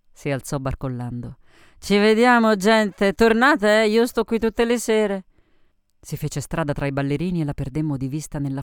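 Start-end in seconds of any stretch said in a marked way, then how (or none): 1.8-5.2 high, loud boozy goodbye; rowdy tipsy send-off; drunken Sophie's farewell. Acted. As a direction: call out brashly to the company.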